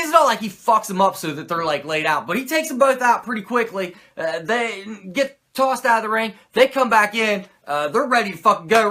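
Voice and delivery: usual speaking voice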